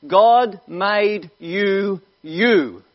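"God made you" stretched very slowly, then a separate "you."; drawn-out stress on "you."; slow emphatic point. Real, not acted. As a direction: land each word, then hit "you." alone. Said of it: "get this".

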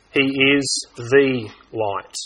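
very slow, "the" weighted and drawn out; slow right down and land on "the"; stress on THE. Shown as a demonstration. Preacher contrasting one light with many, slow stressed "the". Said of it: "The definite article in this verse".

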